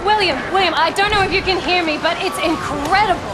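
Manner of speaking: high, urgent and shaken, shouting over the chaos; panicked field report. Acted. Reporter calling back to the studio, panicked and high. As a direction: report from the disaster zone as if barely holding it together. Reporter amid falling debris.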